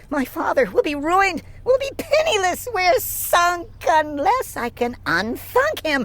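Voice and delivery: high, rising frantic voice; a girl fearing her father's ruin; panicked. A narrator's reading. Sister fearing ruin, frantic high voice.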